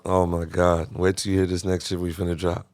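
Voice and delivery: usual speaking voice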